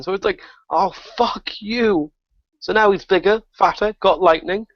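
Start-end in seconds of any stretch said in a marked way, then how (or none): 0.7-2.1 slow, higher, dragged-out "Oh, fuck you!"; exasperated outburst. Retold or imitated. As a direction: stretch it out in mock disbelief. Gamer reacts as boss powers up.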